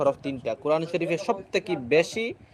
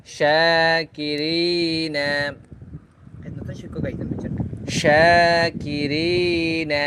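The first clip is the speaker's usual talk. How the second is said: each word drawn out slowly with long madd; elongated vowels; much slower than usual. Shown as a demonstration. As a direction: read each word stretching the vowel one alif. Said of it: "এক আলিফ লম্বা করে পড়ব". (মদ টেনে ধীরে পড়া)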